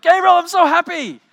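imitating a friend squealing with happiness; overjoyed. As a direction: burst out with delight at the good news. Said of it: "He's like".

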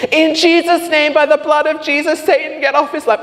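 loud, high-pitched deliverance prayer; fervent mock prayer. Woman praying over him in the pub, fervent loud imitation.

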